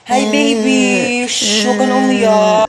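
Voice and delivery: slow, drawn-out hushing; soothing. Acted. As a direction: coo to calm a crying baby. Hushing a crying baby. (mabagal na pagpapatahan)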